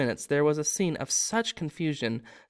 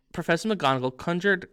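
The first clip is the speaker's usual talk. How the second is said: ordinary delivery, nothing marked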